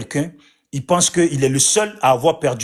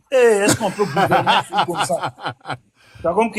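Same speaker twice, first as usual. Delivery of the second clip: incredulous question dissolving into laughter; laughing in disbelief; 3 semitones higher than usual. Real, not acted. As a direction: break into real laughter while talking. Amusé par les jurons de l'autre. (en riant, incrédule)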